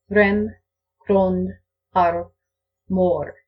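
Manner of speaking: "Rem", "grond", "arv", "mor" with a tapped r like in "butter"; fake rolled r. Shown as a demonstration. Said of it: "Here's what I sound like with my fake rolled R".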